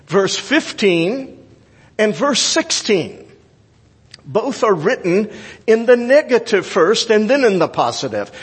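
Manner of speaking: ordinary talk, nothing marked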